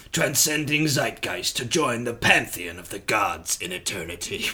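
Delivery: film trailer voice. Acted. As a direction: intone it as a grand cinema tagline. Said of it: "film trailer voice".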